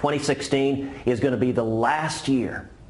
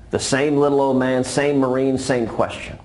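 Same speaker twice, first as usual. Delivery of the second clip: usual speaking voice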